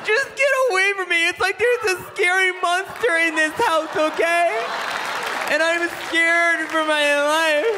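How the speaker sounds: very high, wailing wife voice; hammed-up terrified wailing; hysterical mock fear. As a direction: shriek it in panic, drawing out the end. Hysterical wife in acted quarrel.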